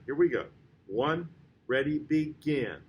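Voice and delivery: normal talking voice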